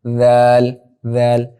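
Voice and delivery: ذیل with the tongue tip out between the teeth; teacher modelling the dhal for students; dhal sound demo. Shown as a demonstration. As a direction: bite lightly and push the tongue out for ذیل. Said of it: "تو پھر کیا ادا ہوگا اس سے".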